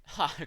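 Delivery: a short, harsh mocking laugh; one sharp contemptuous ha. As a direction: scoff out loud at the Doctor. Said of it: "Why are you laughing?".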